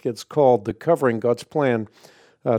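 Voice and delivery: usual speaking voice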